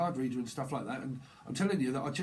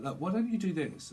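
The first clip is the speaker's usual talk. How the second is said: helpful friend; 7 semitones higher than usual. Acted. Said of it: "someone's very helpful".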